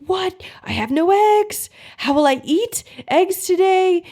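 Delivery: high, loud mock panic; panicked brain. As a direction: squeal it like the brain losing it over missing eggs. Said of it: "your brain is gonna start to think".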